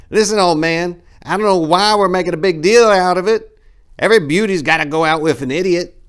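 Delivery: very high, loud smart-mouthed punk; cocky young punk. Acted. Four-way mouthing off at Apricot, high loud sass. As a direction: sass the old man. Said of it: "said Four-way".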